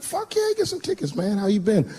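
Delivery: high eager yelp of delight; excited. Retold or imitated. A comic answering his old friend's call, high and excited. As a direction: sound thrilled to hear from an old friend. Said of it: "I was like".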